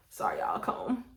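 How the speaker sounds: near-whispered, pulling herself together; composing herself. Real, not acted. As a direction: breathe and calm yourself under your breath. Composing herself mid-story.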